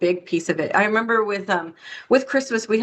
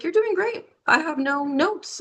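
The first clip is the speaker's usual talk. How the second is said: upbeat boss; 7 semitones higher than usual. Retold or imitated. Boss voiced in retold story.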